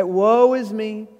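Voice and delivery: pity party whine. Acted. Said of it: "what my mom would call the pity party".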